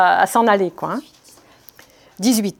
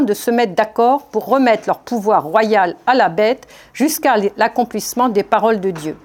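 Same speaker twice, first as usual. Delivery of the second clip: usual speaking voice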